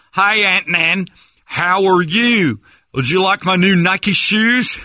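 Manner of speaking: high, slow, loud American caricature; hammed-up American as foreigners mimic it; mock American accent. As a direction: stretch every word in a fake American accent. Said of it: "my nephews have an American accent".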